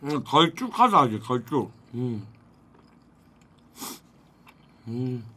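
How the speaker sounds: talking between bites; muffled chewing speech; mouth full, chewing. Real, not acted. Seen 2: cheeks full, mouth working, eating from tteokbokki pan throughout.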